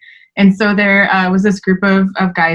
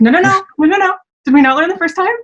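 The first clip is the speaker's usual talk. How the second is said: high-pitched mock exasperation; a higher, teasing no-no-no; teasing scold; 11 semitones higher, faster than usual. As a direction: scold him playfully with a lifted voice. Retelling her playful rebuke.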